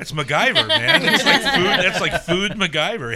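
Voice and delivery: loud, high excited exclamation; excited shout. Real, not acted. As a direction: shout it with delight. Excited shout about built smoker.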